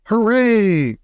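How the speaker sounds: high joyful cheer. A narrator's reading. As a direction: whoop with delight. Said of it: "cried Mister Wonka".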